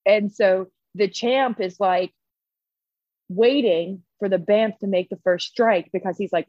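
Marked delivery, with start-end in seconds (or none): none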